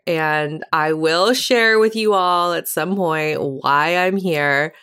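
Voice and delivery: usual speaking voice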